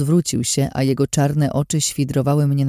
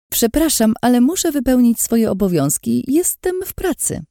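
higher, hurried nervous excuse; said quickly; 9 semitones higher than usual. Acted. Waitress backing away from Lin, voice climbing nervously. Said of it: "rzuciłam szybko".

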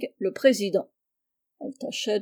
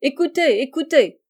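loud, higher-pitched call; 4 semitones higher, 6 dB louder than usual. A narrator's reading. Joe cheering on the new member's speech, calling out. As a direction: call the meeting to listen. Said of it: "cria Joe".

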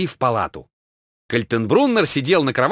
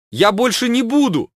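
high whimpering promise; mock tearful drawl; whiny drawl; 12 semitones higher, 4 dB louder than usual. A narrator's reading. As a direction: whine it like a scolded child. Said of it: "плаксиво протянул он".

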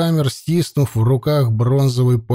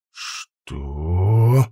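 медленно; 7 semitones lower than usual. A narrator's reading. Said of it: "медленно повторил Джериш".

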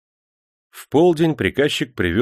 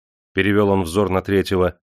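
ordinary delivery, nothing marked

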